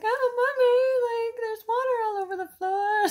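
put-on high, loud, crying child voice, fast; whiny kid. Kids telling mommy about the water, in a whiny wail. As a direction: wail it like a panicked little kid. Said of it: "the kids are like".

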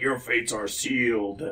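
lightly voiced villain pronouncement; slow, mock-ominous verdict; Shredder. Villain dooming his henchmen, hint of menace. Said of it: "Shredder pops up, it's like".

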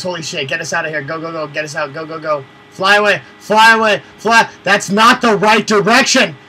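frantic, loud and high; panicked yelling. Real, not acted. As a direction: yell in a panic to escape. Fleeing enemy in helicopter, yelling.

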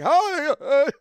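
high, loud, nervous greeting; mock-panicked hello trailing into stammers; chickening out. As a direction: squeak out an awkward hi, imitating the neighbour. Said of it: "he pussied out".

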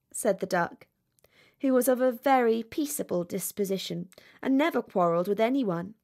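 ordinary delivery

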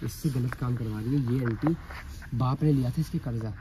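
hushed, low voice for the camera; hushed commentary. Acted. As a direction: tell the viewers quietly so the aunt won't hear. Secret commentary near the aunt. (कैमरे के लिए छिपकर धीमी, दबी आवाज़ में बताना)

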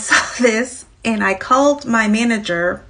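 normal talking voice

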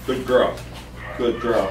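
low, soft praise to a dog; quietly reward the dog; soothing low murmur of approval; loving praise. Real, not acted. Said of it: "Here's my love".

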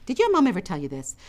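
plain reading voice

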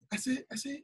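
usual speaking voice

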